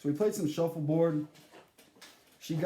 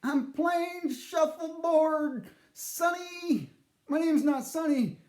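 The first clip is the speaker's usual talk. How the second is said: put-on high, slow old lady voice; creaky granny, drawn out; 10 semitones higher, slower than usual. Nana the ghost answering her grandson, old granny voice.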